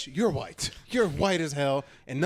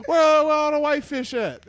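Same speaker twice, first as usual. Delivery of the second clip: high, loud comedian's punchline delivery; hammed-up comic; 10 semitones higher, 7 dB louder than usual. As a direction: holler it as a mock stand-up bit. Riffing a fish stand-up comic.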